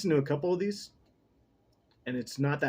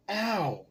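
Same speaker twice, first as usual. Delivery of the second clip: pained ow; 6 semitones higher than usual. Real, not acted. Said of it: "Fucking hot tea".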